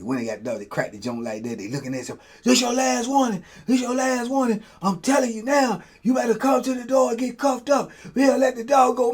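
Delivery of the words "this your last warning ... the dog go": higher, stern guard warning; guard's last warning. Retold or imitated. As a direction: threaten to let the dog loose. Guards' final warning through door.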